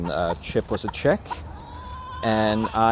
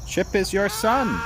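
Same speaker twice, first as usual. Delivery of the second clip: higher pleading reminder; 11 semitones higher than usual. Real, not acted. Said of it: "repeated to the hen".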